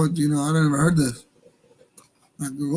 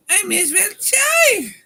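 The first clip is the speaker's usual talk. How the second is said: very high, drawn-out loud cry; mock wail; 5 dB louder, much slower than usual. Host joking after laughing, high mock wail. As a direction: wail it slowly at the top of the voice.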